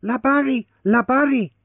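high, loud triumphant calling; exultant cry. Acted. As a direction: shout it out in triumph. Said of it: "crying exultantly".

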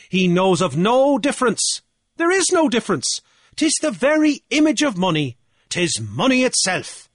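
high, loud gloating declaration; assured triumph. A narrator's reading. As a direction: proclaim a won argument. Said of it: "Assured triumph was in Deasy's voice".

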